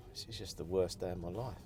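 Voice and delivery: ordinary talk, nothing marked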